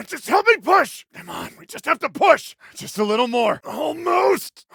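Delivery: high, loud, effortful voice; straining with effort. Acted. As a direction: heave the words out while pushing. Straining to push a heavy thing.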